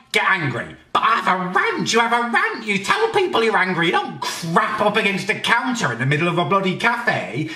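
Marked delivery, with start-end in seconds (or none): none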